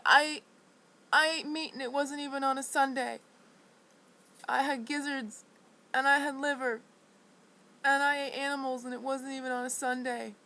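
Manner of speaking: loud, high, anguished shout; yelling a confession up into the rain; shouting to God. Retold or imitated. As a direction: cry it out to the heavens. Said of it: "I screamed it really loud".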